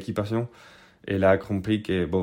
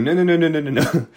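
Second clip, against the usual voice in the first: higher, louder frantic no; panicked denial; 7 semitones higher, 5 dB louder, faster than usual. Retold or imitated. Panicking at the border cop, frantic and loud. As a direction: blurt the nos out in a panic. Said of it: "j'étais comme".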